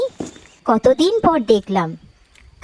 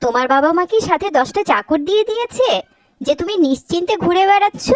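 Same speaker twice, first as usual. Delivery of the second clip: ordinary delivery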